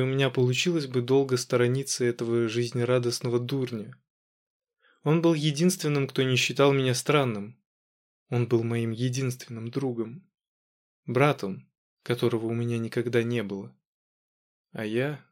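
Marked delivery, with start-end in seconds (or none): none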